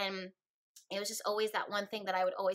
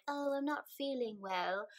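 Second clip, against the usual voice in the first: slightly higher, softer put-on British accent; 3 semitones higher, 4 dB quieter than usual. Telling friends an excuse to stay home, British accent. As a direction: slip into a mock British excuse. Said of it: "had a British accent there".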